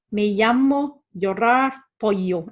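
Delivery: llamo, llorar, pollo with a Y for the LL; slow, say LL like English Y in llamo, llorar, pollo; Spanish LL in llamo, llorar, pollo as Y; double L as Y. Shown as a demonstration. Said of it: "It's a Y sound".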